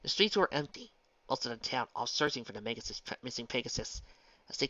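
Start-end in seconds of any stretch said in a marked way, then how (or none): none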